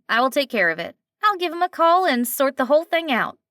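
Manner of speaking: a kind waitress taking charge with a twang; eager nasal twang; lively twang. Acted. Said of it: "slight twang to it".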